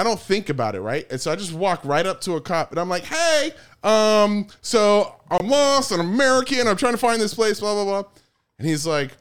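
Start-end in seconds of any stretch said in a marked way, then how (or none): 3.0-8.1 high, loud, over-friendly tourist act; lost American tourist. Retold or imitated. Stoned tourist asking a cop for directions, high and over-friendly. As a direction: hail someone for directions, pitched up high.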